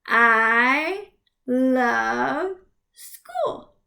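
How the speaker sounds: drawn out very slowly; teacher dragging out every word. Shown as a demonstration. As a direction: stretch the sentence out. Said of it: "clap it out".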